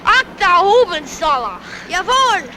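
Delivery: mock German accent. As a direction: put on a thick German accent. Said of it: "like, a foreign accent".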